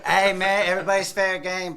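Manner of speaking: ordinary talk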